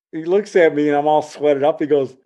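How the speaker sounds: plain reading voice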